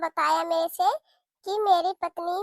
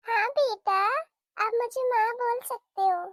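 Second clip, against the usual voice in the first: usual speaking voice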